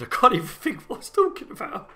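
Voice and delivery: real laugh breaking through. Real, not acted. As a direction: lose the line to laughter. Laughing at himself.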